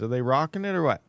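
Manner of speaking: normal talking voice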